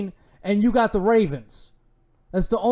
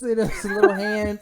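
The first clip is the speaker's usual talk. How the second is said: usual speaking voice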